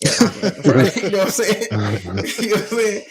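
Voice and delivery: laughing through the words; slow, laughing it out; real laughter while asking. Real, not acted.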